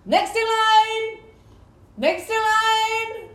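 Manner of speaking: high, loud, drawn-out sing-song call; slow, ringing shop-floor shout; cashier calling out. Acted. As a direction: holler down the queue. Imitating own cashier call at work.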